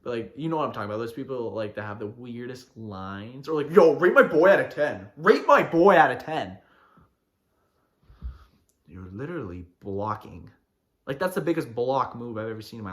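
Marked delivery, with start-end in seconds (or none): 3.7-6.6 loud, brash pickup line; mock wingman holler. Wingman calling to a girl about his boy, mock hollering.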